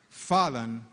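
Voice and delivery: ordinary delivery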